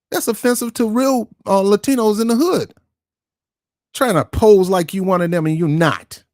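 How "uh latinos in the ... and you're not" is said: ordinary talk, nothing marked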